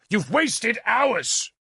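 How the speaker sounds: high, slow, furious rebuke; angry shout. A narrator's reading. Lord berates captain for lost hours, angry. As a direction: hammer each word at him. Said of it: "I shouted".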